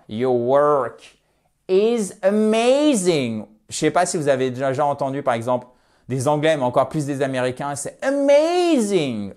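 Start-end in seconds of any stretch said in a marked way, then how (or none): 0.1-3.5 stretched, louder English on "amazing"; English pronunciation model. Shown as a demonstration. Teacher modelling English to French students, drawn-out emphatic demo. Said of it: "on va peut-être pas forcer le trait". 8.0-9.3 high, drawn-out American "amazing"; caricatured American. Retold or imitated. Teacher imitating how Americans say amazing, caricatured American accent. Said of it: "forcer le trait et tomber dans la caricature".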